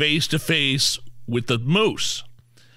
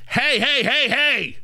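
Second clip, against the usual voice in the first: higher sharp mock mom yell; 11 semitones higher, slower than usual. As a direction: do your best scolding mom. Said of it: "Get ready with your mom voice".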